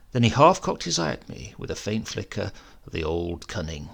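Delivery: ordinary delivery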